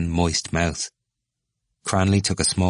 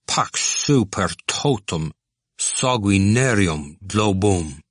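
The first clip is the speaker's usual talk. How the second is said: slow, intoned blessing; a student playing priest with a handball; mock-solemn Latin; 2 semitones higher, much slower than usual. Cranley's mock Latin peace.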